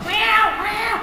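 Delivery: very high mewing; cat meow. Acted. Cat crying outside the door, high meows. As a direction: mew like a stray at the door.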